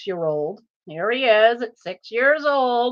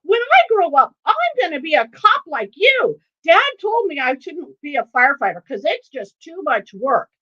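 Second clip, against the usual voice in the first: loud burst, then high eager chatter; excited young son; 8 semitones higher, 4 dB louder than usual. Retold or imitated. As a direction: gush like an overexcited chatterbox child. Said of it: "all excited".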